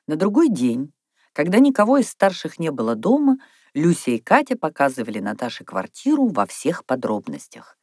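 usual speaking voice

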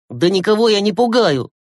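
high, heated retort; losing his temper. A narrator's reading. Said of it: "вышел из себя Глеб". (вспылив, на повышенных тонах)

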